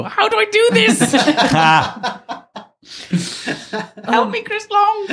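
high squealed plea for help, laughing; mock panic. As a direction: cry out helplessly in comic desperation. Acting out helpless panic on set.

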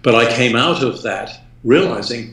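normal talking voice

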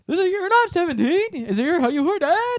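high-pitched stammering questions; hammed-up confusion; mock flustered suspect. Flustered suspect in acted sketch.

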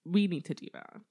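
a drawn-out, slow, fed-up "okay then"; weary resignation. Retold or imitated. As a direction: give in like an exhausted God. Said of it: "God being really tired and being like".

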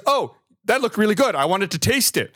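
mock-innocent friend's voice. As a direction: play the oblivious offender explaining himself. Friend's innocent reply in retold quarrel.

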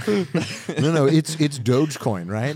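slow, loud mock American tourist; hammed-up American accent ordering food; American impression. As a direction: drag it out like a dim American tourist. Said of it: "I love American impressions".

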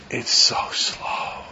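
soft, dragged-out breathy complaint; mock whining. Teacher imitating someone bored with their phone, whining slowly. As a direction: sigh it out like a spoiled phone owner.

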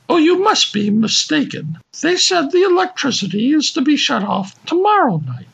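high, fast fussy lady; high woman voice. Acted. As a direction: correct him like a prim lady. Woman voice correcting.

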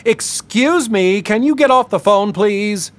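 high, slow, indignant woman's voice; angry woman telling Marlon to hang up, high and sharp. A narrator's reading. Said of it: "a woman's angry voice".

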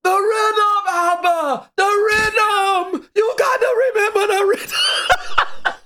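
high, stretched-out yell; screaming across the yard. Retold or imitated. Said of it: "hear him screaming across the yard".

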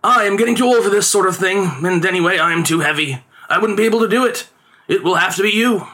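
high-voiced father making excuses; theatrical storybook father, reasoning; father, conjuror. A narrator's reading.

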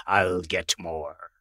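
put-on calm old sea captain, slow and low; Captain Mike. A narrator's reading. Captain Mike reassuring the family at the store, low and slow. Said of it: "but he said".

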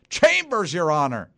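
loud, high opening burst; mock TV lawyer's outburst; mock courtroom prosecutor. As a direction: call out to the judge, hammed-up. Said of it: "prosecutor Jack McCoy in court".

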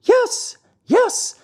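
very high, loud excited scream. A narrator's reading. Pulcinella recognizes Pinocchio on stage, screaming very high. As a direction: shriek with delight at seeing him. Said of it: "screamed Pulcinella".

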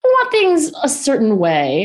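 imitating husband. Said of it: "He's like".

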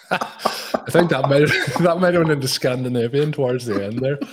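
real laughter breaking into the words; amused laughing. Real, not acted. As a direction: crack up while teasing the bad accent. Host cracks up at the bad accent.

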